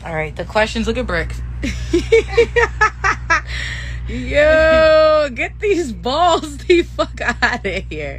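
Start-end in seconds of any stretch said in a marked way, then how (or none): 1.5-4.0 very high cackling laugh; long, helpless burst of laughter. Real, not acted. Cracking up at Bricks. 4.1-8.2 loud, high, drawn-out protest; laughing protest. Real, not acted. Mock protest at Bricks on camera.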